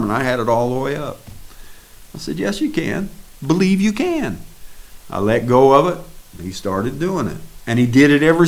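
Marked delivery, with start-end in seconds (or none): 2.4-4.4 firm encouragement. Retold or imitated. Preacher encouraging the man.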